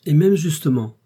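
plus haut et plus fort, un cri de vieille dame; s'écrier avec aplomb; exclamation vive. A narrator's reading. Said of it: "s'écria la douairière". (la douairière renchérit en s'écriant)

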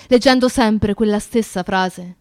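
normal talking voice

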